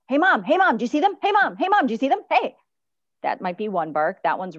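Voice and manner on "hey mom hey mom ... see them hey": high, loud, insistent pestering dog voice; yappy repeated calls for attention; eager barking dog. Acted. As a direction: pester Mom like an excited dog. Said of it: "is she barking 'cause she's like".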